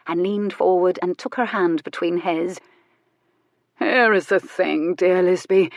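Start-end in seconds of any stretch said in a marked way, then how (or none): 3.8-5.7 slow, mock-solemn pronouncement; solemn and deliberate; great gravity. A narrator's reading. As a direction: weigh each word with grave importance. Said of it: "he said with great gravity".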